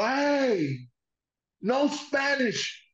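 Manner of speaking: high, far-off shouted English; mock-yell like the wild wrestler. Imitating Dick Murdoch screaming at young José. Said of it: "Salió gritando".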